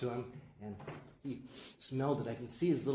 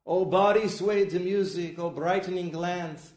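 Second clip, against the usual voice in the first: poem climax, louder and higher; impassioned recital; 7 semitones higher, 11 dB louder than usual. Retold or imitated. Reciting Yeats for the audience, building to a loud passionate peak. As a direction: swell into the poem's climax.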